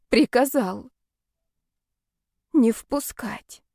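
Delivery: slow, dazed repetition. Acted. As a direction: let each word sink in. Repeating in disbelief.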